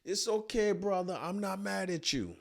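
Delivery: put-on high African-accented reassurance; calm him down in an African accent. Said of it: "he makes a statement twice".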